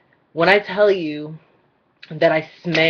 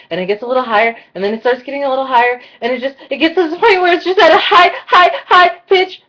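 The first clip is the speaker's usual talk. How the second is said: nervous squeak rising step by step, getting louder; second voice; 9 semitones higher, 7 dB louder, faster than usual. Shown as a demonstration. Demonstrating a nervous employee talking to the boss, voice climbing higher. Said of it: "the voice just gets higher".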